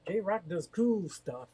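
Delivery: slow announcer read of "J-Rock does cool stuff", "cool" leaned on; announcer title voice. Shown as a demonstration. Streamer rehearses the new title as a slow announcer line. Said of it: "Gotta change your title".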